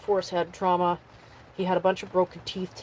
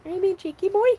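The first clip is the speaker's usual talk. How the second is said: much higher, playful cooing at the dog; high teasing pet talk; 12 semitones higher, 4 dB louder, faster than usual. Real, not acted. Owner teasing her cheeky dog, high playful baby talk.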